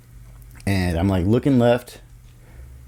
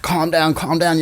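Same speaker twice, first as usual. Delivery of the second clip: high-pitched pleading quote; imitated frantic driver; 8 semitones higher than usual. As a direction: imitate a freaked-out woman urging calm.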